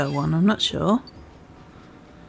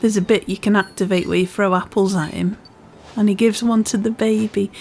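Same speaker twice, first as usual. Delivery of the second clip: usual speaking voice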